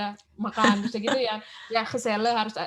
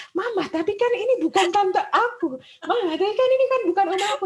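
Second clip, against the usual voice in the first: put-on high kid voice, whining to mama; children protesting to their mom, high whiny voice; childish whine; 10 semitones higher, 6 dB louder than usual. Kids protesting about calling adults tante.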